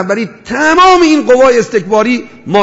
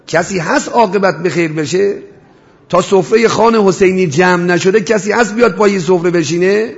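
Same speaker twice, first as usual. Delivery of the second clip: ordinary talk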